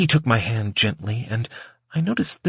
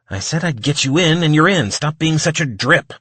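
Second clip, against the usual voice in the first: higher, louder scolding; irritated retort; 5 semitones higher, 5 dB louder than usual. A narrator's reading. Mike scolds the complaining waiter, raised irritated voice. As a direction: tell off a whiner.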